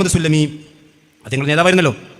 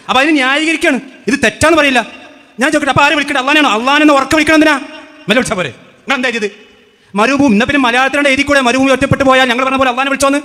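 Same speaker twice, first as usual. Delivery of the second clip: raised, higher-pitched debating voice; heated challenge; 5 semitones higher than usual. Real, not acted. Debater confronting the rival side over its accusation, heated and raised. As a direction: fire the questions at your opponent, voice pushed up.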